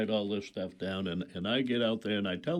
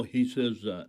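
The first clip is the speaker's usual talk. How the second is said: ordinary delivery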